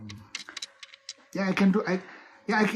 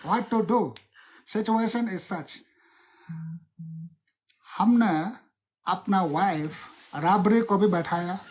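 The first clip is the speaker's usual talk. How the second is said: rustic politician's Hindi, fast in bursts; Lalu Prasad Yadav; 3 dB louder, much faster than usual. Acted. Politician explaining lost elections, comic impression. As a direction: play a folksy politician fielding questions in Hindi. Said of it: "Act like Lalu Prasad Yadav lo".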